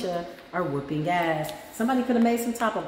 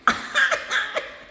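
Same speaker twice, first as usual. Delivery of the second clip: shrill breathy laugh burst; high squeal of laughter. Real, not acted. Laughing at her own old-man dancing story.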